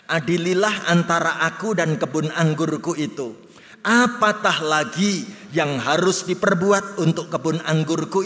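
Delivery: loud, steady reading of scripture together; loud communal scripture reading. Acted. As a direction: declaim the verses in unison. Congregation reads scripture aloud.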